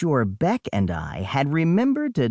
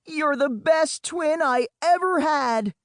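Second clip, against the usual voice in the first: high, raised melodramatic cry; shouted; 12 semitones higher than usual. Acted. Boy shouts to his twin facing sharks, theatrical cry. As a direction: yell it like last words. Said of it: "I shouted".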